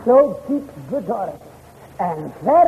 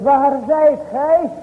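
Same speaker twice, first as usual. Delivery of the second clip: calling out; 4 semitones higher, slower than usual. Shown as a demonstration. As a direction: call it out slowly across a distance. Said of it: "Maar hij roept". (luid en langzaam roepend, "zijt" uitgerekt)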